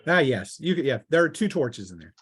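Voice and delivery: ordinary talk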